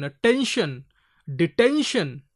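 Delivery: "attention, detention" with ten punched in both; stress on ten. Shown as a demonstration. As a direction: lean on the middle of "attention, detention". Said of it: "So here stress is given".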